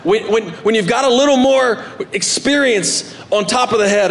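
ordinary talk

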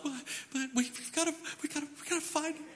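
high, hushed, rushed and pleading; frantic mom. Retold or imitated. Mom pleading with dad to find the lost boy, frantic and high. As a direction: squeak it out in a fluttering panic. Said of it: "and the mom's like".

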